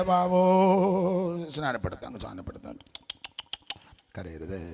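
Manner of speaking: put-on soft, whiny baby wail, stretched out; mock crying baby. Mocking infant baptism, he voices a wailing baby.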